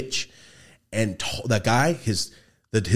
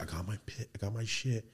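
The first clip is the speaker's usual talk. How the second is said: hushed; 15 dB quieter than usual. Retold or imitated. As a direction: keep it down, intruder nearby. Quoted dealer, hushed, intruder nearby.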